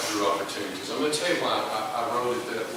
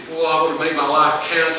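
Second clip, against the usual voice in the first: eager mock boast; 5 semitones higher, 7 dB louder than usual. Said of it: "we talk about being used".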